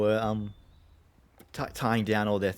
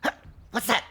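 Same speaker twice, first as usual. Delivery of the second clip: startled goblin; 5 dB louder, much faster than usual. Acted. Goblin hears the party.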